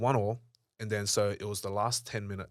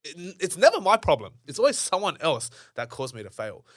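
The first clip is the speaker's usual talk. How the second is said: mock blame-shifting; 6 semitones higher, 7 dB louder than usual. Speaker imitating everyone who dodges blame, mock indignation. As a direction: overplay the innocent victim.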